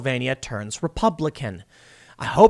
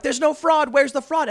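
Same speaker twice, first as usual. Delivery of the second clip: mocking taunt; 10 semitones higher than usual. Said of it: "these leftists are like".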